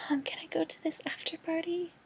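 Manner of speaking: high whispered whine; imitating a teen asking permission; mock pleading daughter. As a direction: beg mom in a tiny voice. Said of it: "and is like".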